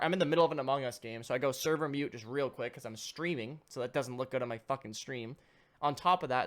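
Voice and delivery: normal talking voice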